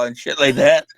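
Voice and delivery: normal talking voice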